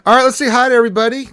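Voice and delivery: big, fast, shouted hello to chat; high-energy streamer welcome; loud hype greeting. Real, not acted. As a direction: boom it out to open the stream.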